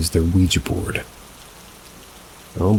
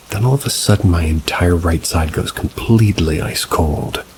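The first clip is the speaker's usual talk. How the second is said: ordinary delivery, nothing marked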